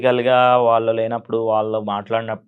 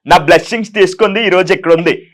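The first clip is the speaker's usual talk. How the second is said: high, loud self-praising preacher voice; a showy, loud public-figure monologue; KA Paul impression; 6 semitones higher, 5 dB louder than usual. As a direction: boast grandly about your global following. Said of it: "KA Paul గారిది చేస్తారా?".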